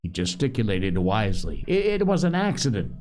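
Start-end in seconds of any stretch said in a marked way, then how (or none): 1.7-2.9 high, fast, stammered insistence; shrill. A narrator's reading. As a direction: protest shrilly, tripping over the start. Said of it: "shrilled again".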